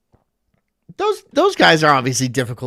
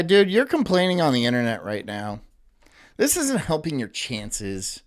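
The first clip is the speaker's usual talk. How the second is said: usual speaking voice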